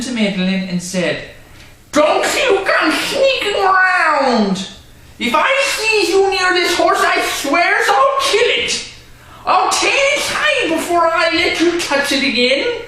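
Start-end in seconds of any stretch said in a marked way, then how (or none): 1.9-12.8 threatening. Acted. Drunk owner threatening the girl as he leaves, menacing.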